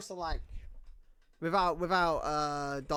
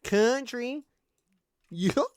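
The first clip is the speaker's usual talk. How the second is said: high, loud cartoonish Southern twang; goofy southern accent; 9 semitones higher, 6 dB louder, much slower than usual. Shown as a demonstration. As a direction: overdo a Southern accent until it turns goofy. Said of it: "when I try to do a southern accent".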